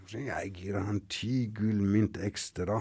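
unvoiced whisper; hushed, confiding narrator aside. A narrator's reading. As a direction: drop to a secretive whisper.

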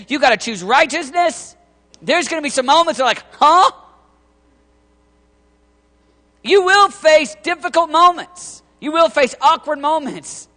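high, loud, driving sermon voice; fiery preaching. Acted. Preacher rallies the tour team, full-force preaching. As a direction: preach it with full fire to the crowd.

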